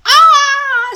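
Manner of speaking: very loud, high cry of shock; a big man's startled shout; shocked bellow. A narrator's reading. Stoic startled entering the room, loud cry.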